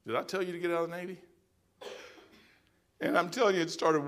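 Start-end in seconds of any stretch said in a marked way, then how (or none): 0.1-1.2 soft and fast; a hushed, quick question; quiet voice of God. Retold or imitated. God questioning the preacher about leaving the Navy, soft and quick.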